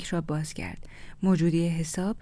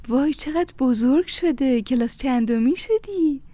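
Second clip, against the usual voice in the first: put-on high, loud, drawn-out cooing over a child; gushing delight; 7 semitones higher, 7 dB louder than usual. A narrator's reading. Neighbour fussing over little Amir, gushing with delight. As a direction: gush at how the child has grown.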